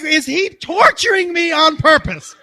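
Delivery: slow, loud, laughing exasperation; mock exasperated yell. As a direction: half-shout it, cracking up. Retold exasperated thought, shouted.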